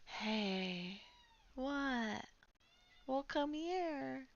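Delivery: high, slow sing-song calling to the cat; pet talk. Real, not acted. Streamer coaxing her cat over, high sing-song pet voice. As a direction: coax the cat in a high, drawn-out voice.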